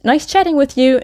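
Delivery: high, bright-spirited call; cried out cheerily. A narrator's reading. Maud calling to policeman as she zooms off, crying out. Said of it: "she cried and zoomed off".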